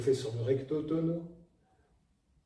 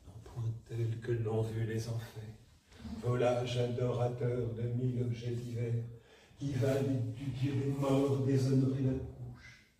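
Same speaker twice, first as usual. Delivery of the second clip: soft warm-up; 6 dB quieter than usual. Shown as a demonstration. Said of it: "il faut s'échauffer ... avec le moins d'effet possible".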